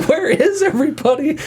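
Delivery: high, laughing, lost; panicked soldier. Retold or imitated. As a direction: ask it in comic panic. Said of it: "it's the other scene where".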